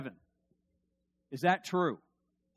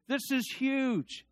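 ordinary talk, nothing marked

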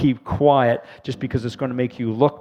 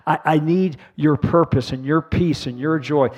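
prayer; 3 semitones higher, 5 dB louder than usual. Real, not acted. Prayer for newcomers.

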